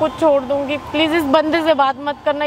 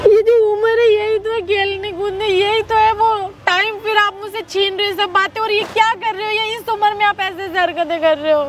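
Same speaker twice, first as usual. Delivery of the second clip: high-pitched crying complaint; tearful protest; 4 semitones higher than usual. Acted. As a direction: plead in a raised, weeping voice. Daughter cries, accusing mother.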